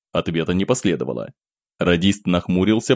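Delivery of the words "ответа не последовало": usual speaking voice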